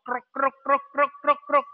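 low, even staccato croaks; toad croaking. Shown as a demonstration. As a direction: imitate a toad in short bursts. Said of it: "you hear the sound of".

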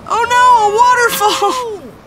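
very high, loud alarmed cry. Acted. Narrator as Poopsie reaches the waterfall, a high loud cry. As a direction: cry out in horror. Cat falls in river.

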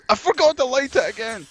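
high, loud exasperated yell; frantic shout. Real, not acted. Yelling at own mistake.

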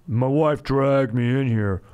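loud, drawn-out hammed-up husband; slow, booming mock complaint; joking husband customer. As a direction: play a husband loudly groaning about being dragged shopping. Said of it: "guy comes in with his wife".

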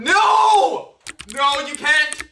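real shout. Real, not acted. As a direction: shout him down in panic. Friend trying to order online.

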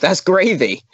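hammed-up Coronation Street catchphrase; Coronation Street accent. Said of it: "something from Coronation Street".

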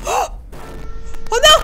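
very high, loud alarmed cry; frightened cry. Real, not acted. Elevator malfunctions, fright.

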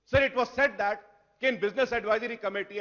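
ordinary delivery

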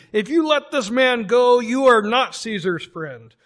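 loud, pressing crowd cry. A narrator's reading. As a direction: shout the threat at the governor.

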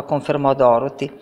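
plain reading voice

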